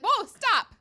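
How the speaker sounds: sudden high loud alarm; alarmed stop. Retold or imitated. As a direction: stop the kid right now. Mom alarmed at fork.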